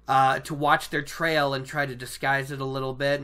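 normal talking voice

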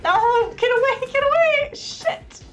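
rising frantic shouts; panicked yelling. Real, not acted. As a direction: yell to get away. Fleeing enemy in game.

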